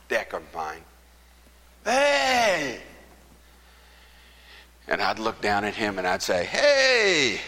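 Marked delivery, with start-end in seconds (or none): 1.8-2.8 calling out. Retold or imitated. Calling up to deck from lot. 6.5-7.4 calling out. Retold or imitated. Calling back down to the man.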